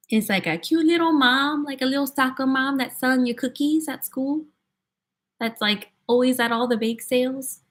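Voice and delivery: cute little soccer mom with a Minnesota lilt; Minnesota accent. Acted. Mom selling cookies at school, in a Minnesota accent. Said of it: "That's the Minnesota accent to me".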